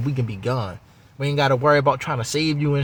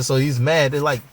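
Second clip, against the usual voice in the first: ordinary talk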